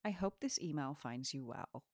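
soft, careful imitation of American accent; imitated American accent. Shown as a demonstration. Co-host learning host's accent from her phrase, imitated American accent. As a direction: copy an American accent quietly, almost whispered. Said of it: "that's what I actually sound like".

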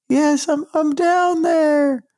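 very high, slow little voice from far below; tiny voice. Pretend. Host answering from his pit of despair in a tiny high voice. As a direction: squeak it up like a tiny person at the bottom of a pit. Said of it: "you hear this tiny voice".

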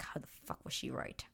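hushed retold exasperation; pissed-off muttering. Man losing the horse race bet, quietly pissed. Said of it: "just pissed 'cause he's like".

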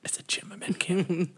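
playful whisper. Pretend. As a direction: whisper the punchline. Whispered joke continues.

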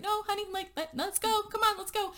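high, gentle imitated pleading; lightly voiced boyfriend coaxing his love. Shane coaxing Rachel out of the bathroom, high and gentle.

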